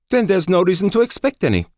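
high, loud commanding officer voice; crisp female officer, firm and high; female colonel voice. A narrator's reading. Colonel Schoen voiced by narrator.

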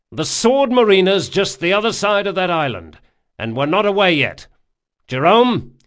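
loud, firm captain addressing crew; rally the men from the deck; commanding captain. A narrator's reading. Locke gives orders.

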